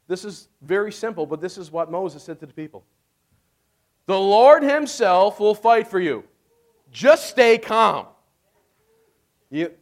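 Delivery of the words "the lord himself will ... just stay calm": slow, weighty, louder scripture quote; solemn scripture read. Shown as a demonstration. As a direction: lean into each word of the promise. Quoting Scripture, emphatic.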